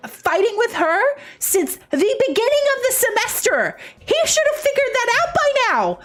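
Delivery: put-on outraged Valley Girl gossip, climbing high. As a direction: gush and seethe like a Valley Girl. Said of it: "I can do Valley Girl".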